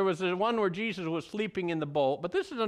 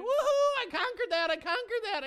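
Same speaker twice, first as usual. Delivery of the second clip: much higher, laughing mock victory cheer; gleeful whoop; 12 semitones higher than usual. Preacher mocks a believer's early triumph, gleeful and laughing. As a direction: crow over a beaten temptation, hammed-up. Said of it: "and we think".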